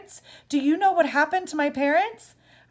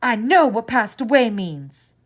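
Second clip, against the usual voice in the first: furious, louder and higher outburst; screaming; 4 semitones higher, 7 dB louder than usual. A narrator's reading. Grieving child screams at the clerk, furious and loud. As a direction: let it all explode at once. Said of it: "I screamed".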